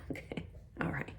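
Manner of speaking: quick whispered aside; whispering. Real, not acted. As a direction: whisper it fast under your breath. Whispered aside after a laugh.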